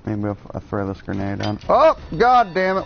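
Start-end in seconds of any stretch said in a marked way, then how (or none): 1.7-2.9 sudden loud high yelp of dismay; startled curse. Real, not acted. Gamer yelps as his own grenade backfires, startled loud curse. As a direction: blurt it out as the grenade goes off.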